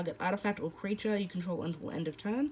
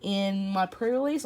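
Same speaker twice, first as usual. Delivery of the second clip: ordinary delivery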